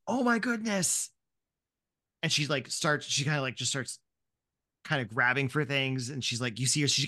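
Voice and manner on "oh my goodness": high, flustered little gasp of a mom; a mom caught off guard, pitched up. Acted. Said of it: "she turns back and she goes".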